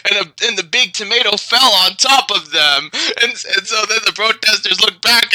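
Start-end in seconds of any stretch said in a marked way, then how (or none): none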